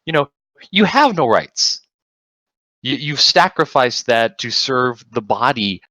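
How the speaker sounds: real rising enthusiasm, pitch climbing high; worked up. Real, not acted. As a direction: get carried away by your own point. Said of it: "I'm getting worked up".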